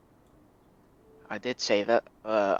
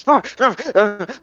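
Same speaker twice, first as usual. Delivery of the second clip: put-on startled old sailor; 10 semitones higher, 8 dB louder than usual. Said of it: "and he's like".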